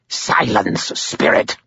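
clipped harsh rebuke to the spirit; stern master silencing his servant; harsh command. Acted. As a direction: shut the servant up. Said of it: "Cowell said in a harsh voice".

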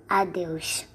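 ordinary delivery, nothing marked

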